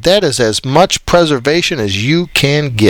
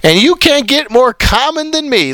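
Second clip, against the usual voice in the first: ordinary talk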